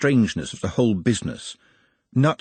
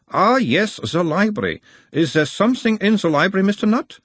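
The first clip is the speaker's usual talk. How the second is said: put-on a mild Uberwaldian accent, the higher voice of a gentle examiner; 11 semitones higher, 3 dB louder than usual. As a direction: question him in a light Uberwald accent, calming the patient. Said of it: "talking like someone from Uberwald".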